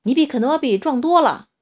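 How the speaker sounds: loud, high angry yell; shouting. A narrator's reading. Little dinosaur shouting at the huge T-rex to shame him. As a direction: scold the giant at full voice. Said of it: "椰子冲着巨大的乌提莫大吼".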